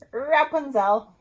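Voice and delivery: plain reading voice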